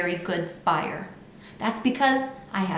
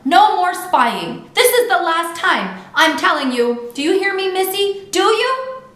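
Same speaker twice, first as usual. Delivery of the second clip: angry yell; 10 semitones higher, 7 dB louder than usual. A narrator's reading. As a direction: scold the child at the top of your voice. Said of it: "she yelled".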